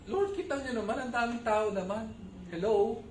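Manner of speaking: whiny over-the-top disciple griping about the crowd; exaggerated disciples. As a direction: ham up the fed-up disciples pleading with the Lord. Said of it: "medyo exaggerated ako, magkwento".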